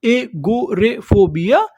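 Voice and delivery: एगोरेफोबिया slowly in separate syllables, rising at the end; slow teaching breakdown of एगोरेफोबिया. Shown as a demonstration. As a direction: sound out एगोरेफोबिया piece by piece. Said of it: "उसे हम लोग क्या बोलते हैं".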